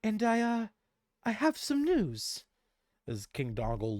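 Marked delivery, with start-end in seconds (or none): 0.0-2.4 higher, softer princess voice; sweet princess holding a secret; higher gentle princess voice; princess daughter. Acted. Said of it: "she looks down to him".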